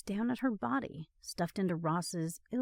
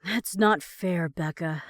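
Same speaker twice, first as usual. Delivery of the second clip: usual speaking voice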